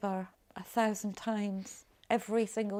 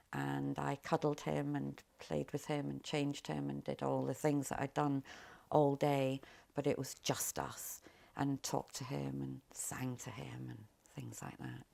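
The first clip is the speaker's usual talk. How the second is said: hushed; 4 semitones lower, 7 dB quieter than usual. Retold or imitated. Tender night memory with baby.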